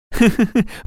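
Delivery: high, short acted laugh; amused giggle. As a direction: giggle at a funny word. Father's funny word amuses son. (высокий смешок)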